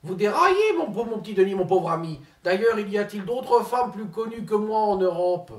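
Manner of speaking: pompous politician impersonation; Ségolène Royal impression. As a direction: play the self-important minister, lofty and indignant.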